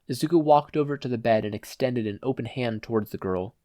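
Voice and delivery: usual speaking voice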